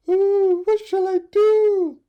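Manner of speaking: very high, loud, slowed wail; despairing mother bird. Acted. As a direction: lament helplessly like a mother bird. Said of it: "cried the mother bird".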